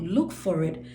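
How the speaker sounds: ordinary delivery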